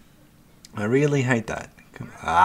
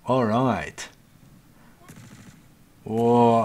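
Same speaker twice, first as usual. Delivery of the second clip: ordinary talk, nothing marked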